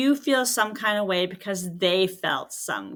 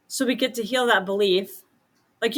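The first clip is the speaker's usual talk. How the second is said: ordinary talk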